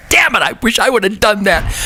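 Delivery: mock the dead legends envying the band; dead rock legends griping. Guitarist voicing [name] and [name] rolling in their graves, jealous.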